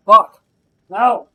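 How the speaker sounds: sudden loud angry shout; frustrated yell. Real, not acted. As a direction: yell it out at the boss. Boss fight going wrong.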